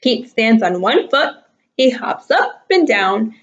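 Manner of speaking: usual speaking voice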